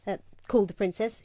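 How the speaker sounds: plain reading voice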